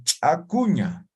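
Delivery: Chacuña said slowly, syllable by syllable; slow word demo. Shown as a demonstration. Teacher shows students Chacuña, said slowly. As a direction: spell out Chacuña slowly for the class. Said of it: "Otro, digamos".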